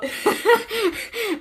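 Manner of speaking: high burst of laughter; laughing. Real, not acted. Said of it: "I'm glad you got a laugh out of that".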